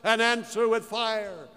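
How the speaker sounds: chanted, drawn-out plea to a false god; crying out to Baal. Acted. Priests cry out to Baal for fire, in a slow chanted plea. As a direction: call slowly upward like a pleading priest. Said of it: "the priests of Baal began to cry".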